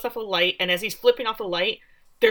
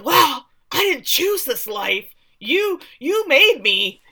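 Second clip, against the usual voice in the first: put-on high, loud, drawn-out teen outburst; hammed-up wail of injustice at a parent; protesting teen; 7 semitones higher, 7 dB louder, slower than usual. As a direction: stretch the words in an exaggerated teenage complaint. Acted teen protest at dad.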